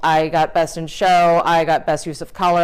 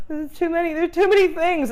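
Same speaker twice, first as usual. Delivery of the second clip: mock overwhelmed whine; 10 semitones higher than usual. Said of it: "I was like".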